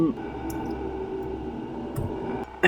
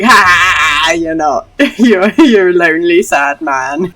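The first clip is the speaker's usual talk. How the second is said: loud scornful laugh into a put-down; mock him with a big "Ha!", half laughing; Asperty; 2 semitones higher, 9 dB louder, slower than usual. Imagined woman mocking a lonely man, loudly scornful. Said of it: "Asperty hearing that and just being like".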